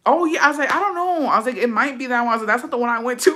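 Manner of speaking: high-pitched mock innocence; flustered denial. Friend covering up which bar it was, high flustered denial. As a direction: play dumb in a high voice.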